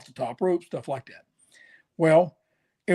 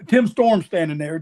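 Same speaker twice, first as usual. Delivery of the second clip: normal talking voice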